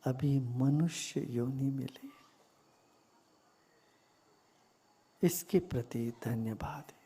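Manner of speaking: calm meditation guide with long pauses; guided meditation. Real, not acted. Teacher leading listeners into gratitude for body and breath, meditative. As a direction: guide them gently into stillness, unhurried.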